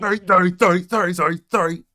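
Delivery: high, loud rushed sorries; a rookie fumbling apologies, high and loud; flustered apologizing. Acted. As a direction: blurt apologies like a clumsy rookie. Player voices clumsy apology.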